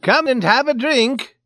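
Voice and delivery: very high, loud urging; big blustering character voice; insistent Swede. A narrator's reading. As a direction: holler the invitation insistently.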